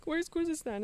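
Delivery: normal talking voice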